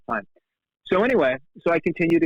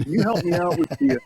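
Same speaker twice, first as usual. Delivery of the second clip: a lightly voiced, higher eager kid asking a favour; eager young fan; 5 semitones higher than usual. The nerd at the booth begging Nick for help, eager. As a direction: plead like a nerdy fan.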